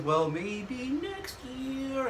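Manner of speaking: slow, soft sung Broadway line; [name] impression. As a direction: croon it softly and slowly. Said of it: "Bernadette says".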